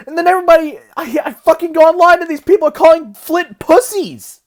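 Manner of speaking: hysterical high pitch. Real, not acted. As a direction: let the voice climb high in real agitation. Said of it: "I'm sorry for the high pitch".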